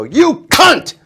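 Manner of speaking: sudden high, loud yell; punchline shouted at the wife. Retold or imitated. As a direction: blurt it out as a shocking yell. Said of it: "And I'd go".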